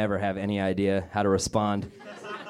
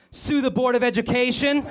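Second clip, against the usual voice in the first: much higher yelled "Sue the Board of Education!"; mock rallying yell; 12 semitones higher than usual. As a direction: call it out like a protester. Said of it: "I just wanna yell out".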